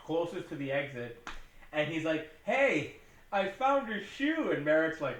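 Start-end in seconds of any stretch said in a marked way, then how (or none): 2.4-4.5 high, loud, drawn-out mock call; hammed-up eager hailing. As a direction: call after someone, stretching the words. Voicing suitor calling to woman.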